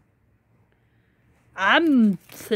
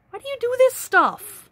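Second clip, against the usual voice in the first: ordinary delivery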